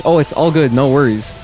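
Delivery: ordinary delivery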